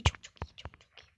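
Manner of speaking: breathy whisper with no voice; whispered praise. Real, not acted. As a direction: whisper it to yourself while concentrating. Quiet self-talk while playing. (fısıltıyla övgü)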